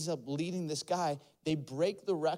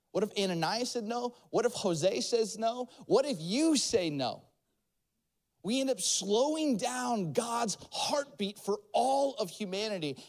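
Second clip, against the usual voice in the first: rising preaching; 6 semitones higher than usual. Real, not acted. Pastor rousing the congregation, then shouting. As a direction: preach it to the rafters, each question bigger.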